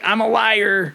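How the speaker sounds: high-pitched mock town-crier cry; half-hearted high yell. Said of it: "running through the town yelling".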